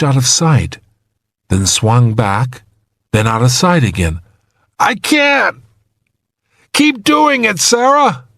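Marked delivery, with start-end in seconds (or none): 4.8-5.6 high, desperate strain. A narrator's reading. Trapped man misses the swinging hook, desperate. 6.7-8.2 high, strained plea; desperate urging. A narrator's reading. Trapped man begs rescuer to keep swinging, desperate.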